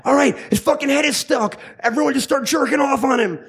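high-pitched mock yell to the gang; excited rallying cry. Rabbit rallying the other rabbits over the stuck hunter, excited mock yell.

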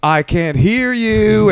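high, slow shouted call to the crowd; deaf hype man yell. Retold or imitated. MC yelling at a deaf crowd, high and slow. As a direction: stretch it out like an MC. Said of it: "somebody up front will be like".

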